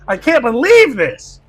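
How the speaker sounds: ordinary delivery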